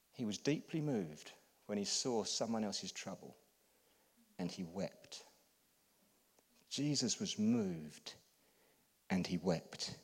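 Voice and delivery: soft, slow, low reflection; quiet tender preaching; hushed and tender. Acted. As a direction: draw them in with a near-whisper.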